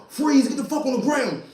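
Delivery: cop yelling freeze. Retold or imitated. Undercover cop yelling at the suspect, high and sharp. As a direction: bark it like a cop jumping out.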